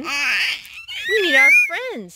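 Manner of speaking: high, slow, loud eager call; excited call. Acted. Skunk telling Raccoon they need friends, excited shout. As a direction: Skunk calling out for his friends, thrilled.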